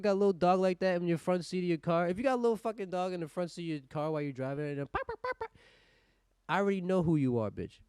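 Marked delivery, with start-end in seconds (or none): 4.9-5.5 very high, quick pops; imitated popping noise. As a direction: squeak out a burst of pops.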